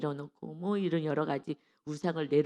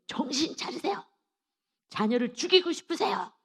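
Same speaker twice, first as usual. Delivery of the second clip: higher, louder mock scolding; retold scolding, raised voice; sharp shout; 7 semitones higher, 3 dB louder than usual. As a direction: snap at the mother to wake her up. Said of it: "소리를 막 지르시더라고요".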